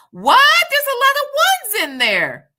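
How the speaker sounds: high, loud, laughing shock; startled laugh at the chat; shocked laughing disbelief. Real, not acted. Shocked at chat's answers.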